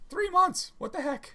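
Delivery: high, amazed squeal; surprised. Real, not acted. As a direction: squeak it in disbelief. Disbelief at time passed.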